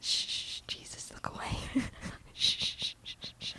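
whispered soothing shushes; calming a dog. Pretend. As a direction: hush him gently like a scared pet. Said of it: "It's like calming my dog down".

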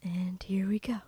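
hushed, breathy start cue; whispering. Real, not acted. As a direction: whisper it before the scene. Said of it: "announces start of dramatic reading".

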